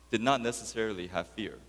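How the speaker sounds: ordinary talk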